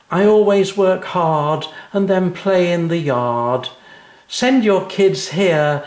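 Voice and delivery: put-on high, slow sing-song recital of a child; a schoolgirl proudly reciting her poem; little girl reciting. A narrator's reading.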